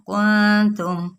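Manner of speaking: وکنتم very slow, nun hidden into ta; slow recitation. Shown as a demonstration. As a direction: recite وکنتم slowly with ikhfa. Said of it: "احفا کرتے ہوئے".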